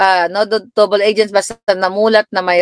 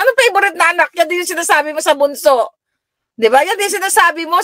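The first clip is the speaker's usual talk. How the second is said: much higher, protesting daughter; teasing daughter; 11 semitones higher than usual. Retold or imitated. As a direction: call out mom's favoritism, whining. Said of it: "sabi ganun". (anak na nagrereklamo nang mataas ang boses)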